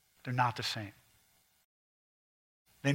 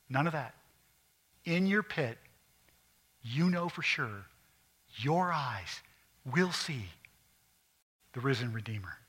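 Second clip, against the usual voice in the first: normal talking voice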